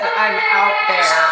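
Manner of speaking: man's voice. Retold or imitated. Replaying what the spirit box said, mock man's voice. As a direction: echo the man's voice from the device. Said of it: "but that was a man's voice".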